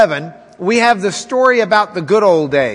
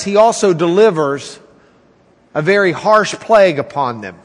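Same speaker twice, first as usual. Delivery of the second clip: ordinary talk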